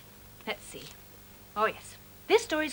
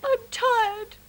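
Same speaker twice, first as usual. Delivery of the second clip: mild pretend sob, higher; sobbed; 10 semitones higher, 4 dB louder than usual. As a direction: whimper it like a tired child. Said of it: "sobbed Mog".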